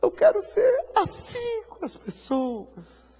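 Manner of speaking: tearful prayer. Acted. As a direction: plead slowly, on the edge of tears.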